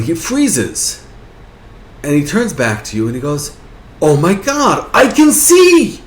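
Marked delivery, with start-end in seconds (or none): 4.0-6.0 high, loud, drawn-out cry of joy; amazed elation. Acted. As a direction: exclaim like a blind man suddenly seeing. Blind man suddenly regains sight.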